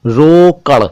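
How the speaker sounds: रोकड़ ending in a flapped retroflex ड़; careful flap. Shown as a demonstration. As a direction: land रोकड़ on a flicked ड़, not र. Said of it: "रोकड़ तो कुछ और".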